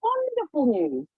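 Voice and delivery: high-pitched, rapturous imitation; overjoyed vicar. Vicar hearing of her visions, overjoyed. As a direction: gush like a thrilled vicar. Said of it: "so happy for me".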